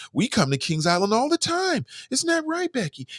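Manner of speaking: hammed-up valley girl. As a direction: parody a chirpy teen on the news.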